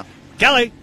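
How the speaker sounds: high, loud yell of a name; demonstrated attention-getting holler; loud name call. Shown as a demonstration. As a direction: shout it up high to get her attention. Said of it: "with that loud".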